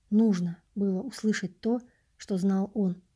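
ordinary delivery, nothing marked

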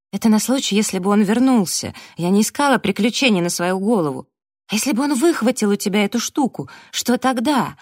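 high, loud, insistent arguing; heated self-defence. A narrator's reading. Abby defending herself against Daniel, heated and insistent. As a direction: argue back hard, climbing to a challenge.

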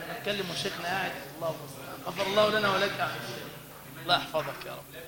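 ordinary talk

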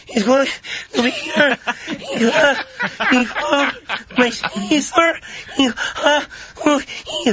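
high, gasping, broken-up phrases. Retold or imitated. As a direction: pant between words, voice way up. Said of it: "Latinos sound like they're out of breath".

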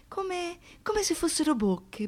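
ordinary talk, nothing marked

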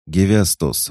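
loud, high call of a name; shouting a name. A narrator's reading. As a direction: shout his name to him. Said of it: "выкрикнул Хаджар".

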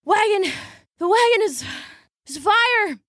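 high, breathless calling her name; gasping. Acted. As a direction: gasp it out after running. Breathless after running.

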